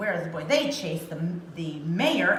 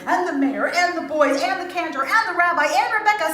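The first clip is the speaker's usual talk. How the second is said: excited; 8 semitones higher, 7 dB louder than usual. A narrator's reading. List of criers, building.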